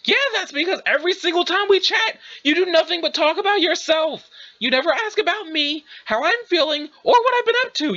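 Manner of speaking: high, heated accusation rising at the end; indignant. Acted. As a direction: pour out the grievance, pitch climbing. Acted quarrel, airing grievance.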